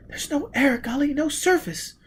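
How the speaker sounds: put-on high, tense girl's voice; frightened young woman. A narrator's reading. Gisbella protesting to Foyle that there is no air. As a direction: pitch up, sound scared.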